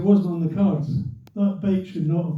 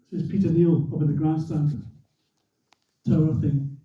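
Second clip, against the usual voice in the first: usual speaking voice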